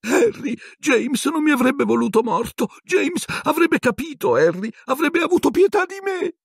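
very high, squeaky pleading; begging wretch. A narrator's reading. Pettigrew imploring Harry in James's name, squeaky begging. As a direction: beg for mercy in a high squeak.

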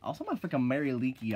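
ordinary delivery

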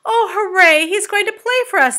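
high, loud cheer; crying out joyfully. A narrator's reading. Friends learning the grasshopper will play, crying out joyfully. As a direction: cheer with delighted excitement. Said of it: "they cried".

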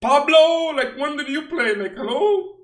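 high, loud, teasing yell; playful disbelief, shouted; mock outraged shout. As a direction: call out the nobody on the card. Mocking an unknown player.

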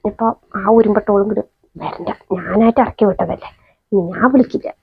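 exhausted mother grumbling quietly to herself at night; muttering aside. Acted. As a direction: grumble under your breath.

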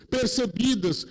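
ordinary delivery

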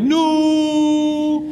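"no" held long on a high, level pitch; drawn-out no. Shown as a demonstration. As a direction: stretch the O in "no" way out. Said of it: "lots of O's in your no".

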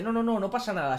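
high, quick-to-dismiss mock reassurance; imitated dismissive reassurance. Clinic worker overruling patient about the leaflet, imitated dismissal. Staff brushing aside patient's objection.

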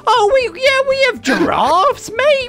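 mock Aussie friend. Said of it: "And you're like".

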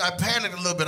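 usual speaking voice

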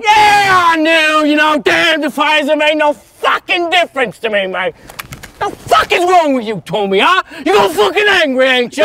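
very high, loud screaming tirade; full-volume enraged outburst; furious shouting. Acted. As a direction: roar the admission back at the accuser. Furious confession in quarrel.

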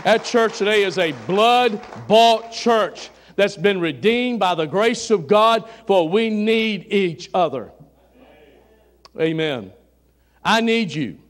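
loud, driving church proclamation; preacher building urgency in full voice; forceful preaching. Real, not acted. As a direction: drive the message home to the congregation.

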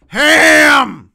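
hammed-up crying shout; sudden loud wail. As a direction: burst out in a mock wail. Punchline after mashed potatoes.

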